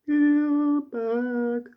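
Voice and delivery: squeaky mocking name; mock high voice. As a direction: announce it in a silly high voice.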